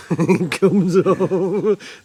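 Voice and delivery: slow, laughing through the words; drawn out while laughing. Real, not acted. Laughing at suicide stand.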